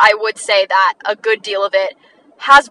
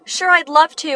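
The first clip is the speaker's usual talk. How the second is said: higher-pitched, willing reply; enthusiastic acceptance; 7 semitones higher than usual. Acted. Friend accepting an invite to hang out, enthusiastic. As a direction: accept with a lifted, upbeat voice. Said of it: "with an explanation point".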